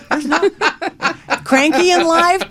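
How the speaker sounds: high giggle breaking into the line; amused chuckle over cranky; laughing. Real, not acted. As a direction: laugh while saying it, slowed.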